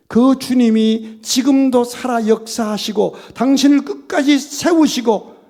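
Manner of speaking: fervent preaching. Acted. Pastor warns the congregation, loud fervent preaching. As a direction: preach it out with full force.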